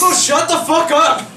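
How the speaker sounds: high, loud shout; fed-up, full-voiced command; exasperated yell. Real, not acted. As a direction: yell it down at the gadget. Yelling at the smart speaker.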